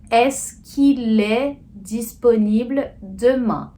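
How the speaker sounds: slow demo, "Est-ce qu'il" linked as "es-kil"; com elisão. Shown as a demonstration. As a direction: run "qu'il" together as one syllable, slowly. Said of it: "você vai ter que fazer a elisão". (elisão lenta: "qu'il" dito "kil", numa sílaba só)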